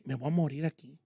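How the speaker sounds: low, soft inner voice of dread; hushed dread. Retold or imitated. Man trapped in car trunk realizing he may die, hushed and low. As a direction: murmur it like a sudden grim realization. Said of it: "me cae el veinte".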